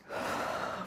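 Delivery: long audible exhale sigh; exaggerated out-breath. Shown as a demonstration. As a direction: demo sigh. Breathing exercise with kids.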